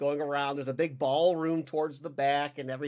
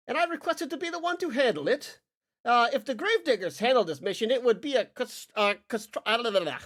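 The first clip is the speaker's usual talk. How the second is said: high, airy aristocratic voice, pitched well up; lightly hammed-up posh noble; fussy noble lord; 8 semitones higher, 4 dB louder than usual. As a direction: play a pompous noble fumbling his words.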